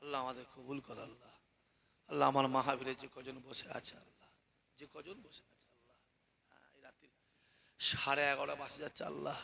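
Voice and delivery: low, very soft supplication; hushed prayer. Real, not acted. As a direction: pray quietly to Allah for the congregation. Prays for the gathering.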